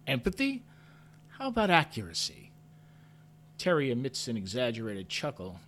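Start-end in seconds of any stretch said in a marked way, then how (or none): 0.1-0.6 high, incredulous scoff; scoffing, pitched-up echo. A narrator's reading. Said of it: "I scoff". 1.4-2.5 scoffing retort, higher and louder; pitched-up scornful comeback. A narrator's reading. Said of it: "I scoff".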